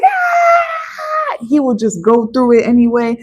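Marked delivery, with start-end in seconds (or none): 0.0-1.4 high yelp of frustration; angry cartoon yell. Finn triggered, letting out a high yelp. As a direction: yelp like an annoyed cartoon hero. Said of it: "He'd be like".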